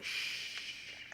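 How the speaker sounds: soft, whispered "shh"; hushing shush. Acted. Game master as fox-faced caster before vanishing, hushing. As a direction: hush them with a long, quiet shush. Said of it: "puts finger to its mouth and it's like".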